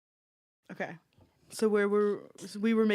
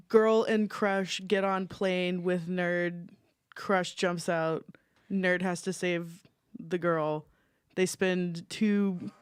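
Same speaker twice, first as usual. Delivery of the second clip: slow deliberate summary; much slower than usual. Real, not acted. Condensed synopsis read out slowly.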